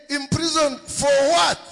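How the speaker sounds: high, loud outraged shout; the king shouts in disbelief; king's shocked shout. Acted.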